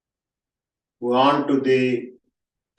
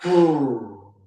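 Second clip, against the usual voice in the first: throaty whoo, higher; voiced whoo sound; 4 semitones higher than usual. Shown as a demonstration. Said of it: "making a sound from the throat".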